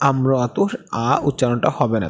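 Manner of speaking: ordinary talk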